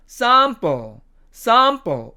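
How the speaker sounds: British "sample" with long ah; British demo of "sample"; British accent. Shown as a demonstration. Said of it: "영국식 발음으로 가면".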